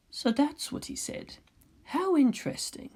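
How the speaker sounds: a hint of a calm, cool boy; soft pale teen. A narrator's reading. Pale teen Kaworu voiced.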